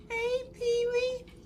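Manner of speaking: high, slow, soft coo; pet talk. Real, not acted. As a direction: greet the shy cat gently up high. Greeting timid cat.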